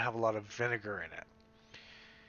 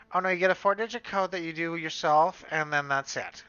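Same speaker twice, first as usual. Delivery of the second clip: high, louder mock of a breezy attendant; chirpy staff voice; 6 semitones higher, 7 dB louder than usual. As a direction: toss off the answer like a cheery clerk. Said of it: "staff reply in retold dialogue".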